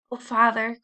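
urgent cry to a parent; pleading exclamation; cried out. A narrator's reading. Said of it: "cried Caddy".

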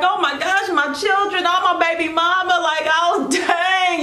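high, hammed-up wail of grief; mock wailing. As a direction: imitate them crying out for their families. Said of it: "they're all crying".

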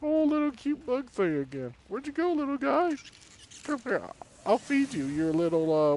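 high cooing pet voice. Real, not acted. Player coaxing the little bug, high and cooing. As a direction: coo to it like a puppy.

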